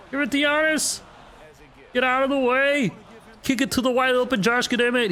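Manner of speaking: high, urgent shouting at the game; excited yelling. Real, not acted. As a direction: yell instructions at the screen. Urgent calls during game.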